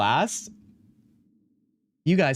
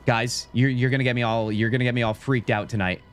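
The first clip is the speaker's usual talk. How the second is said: usual speaking voice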